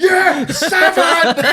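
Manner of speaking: high, slow, laughing through it; laughing mock shout. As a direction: giggle out the teacher's shout. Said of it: "mimicking the shouted seven".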